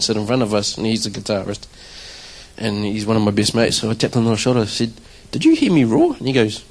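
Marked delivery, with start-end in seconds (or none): 5.3-6.1 eager question. Retold or imitated. Asking his mate about roar.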